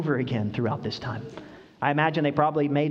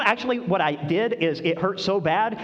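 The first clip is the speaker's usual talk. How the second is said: ordinary talk, nothing marked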